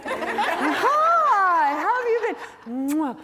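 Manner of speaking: high, sugary fake-nice greeting; high mock-sweet hello to a rival; fake friendly greeting. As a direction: gush over her like a frenemy. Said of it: "So we can be like this".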